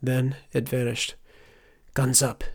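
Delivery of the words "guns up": usual speaking voice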